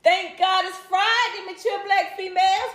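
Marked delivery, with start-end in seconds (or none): none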